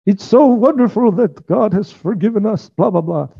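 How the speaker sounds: high, gushing singsong delivery; Nazi guard in retold story. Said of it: "I wish I could do a German accent, but I can't".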